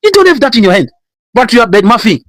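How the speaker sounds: high, rising sermon rebuke; preaching at full force. Acted. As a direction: push every line up like a fiery preacher.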